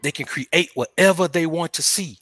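measured, spaced-out words; slow, deliberate repeat. Shown as a demonstration. Man driving home the fantasy world point, slow and deliberate. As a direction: spell it out slowly for emphasis.

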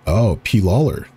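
plain reading voice

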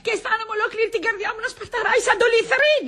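high, rapid invalid wail; hammed-up patient, pitched very high; feigned heart flutter. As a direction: rattle off pretended symptoms in a whine. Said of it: "Έχω ανάγκη από μια καρδιακή κρίση".

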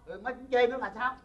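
ordinary delivery

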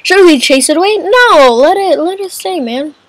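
ordinary talk

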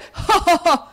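a high, loud wicked cackle; gleeful cackle. A narrator's reading. Squeers cackling over his swindle, high cackle. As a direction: cackle at your own scheme.

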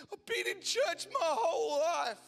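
lightly voiced weeping old man; old volunteer telling the preacher, tearful; blubbering old Englishman. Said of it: "in his strong English accent and his blubbering mess".

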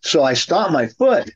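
normal talking voice

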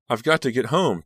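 high, strained urgency; choked out. A narrator's reading. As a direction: force the words out in distress. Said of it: "Danielle choked out".

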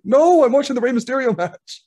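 high, loud mock wail of protest; retold loud protest. Child objecting to dad switching the wrestling off, high indignant cry. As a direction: protest loudly, pitch shot right up, drawing out the NO.

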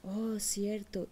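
drawn-out hushed oh of discovery; slow whispered realization. Acted. Eaglet to mother hen, seeing its wings, slow whisper. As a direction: let the truth dawn on you in a whisper.

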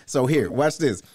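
ordinary delivery

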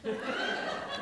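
short high laugh. Real, not acted. Pastor laughing at his own breakfast story, short and high. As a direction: burst out laughing at the joke.

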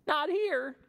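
high, bright parrot voice; squawky parrot. Acted. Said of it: "says Parrot".

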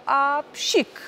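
a high, hammed-up sneeze. Wardrobe sneezes while answering the baubles, mock sneeze. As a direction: let the wardrobe sneeze again. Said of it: "szafa znowu kichnęła".

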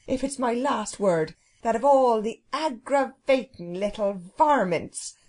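homely servant working up into a scolding; indignant Irish cook. Acted. Cook speaking her mind to the children, indignant. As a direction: build to an exasperated telling-off.

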